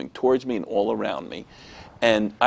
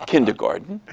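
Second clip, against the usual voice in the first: normal talking voice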